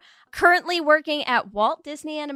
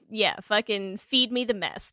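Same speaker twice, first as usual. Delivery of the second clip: normal talking voice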